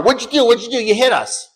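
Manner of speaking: high, agitated mock yell; retold yelling. Yelling at the driver of our car, retold angry yell. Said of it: "They're yelling at the driver of our car".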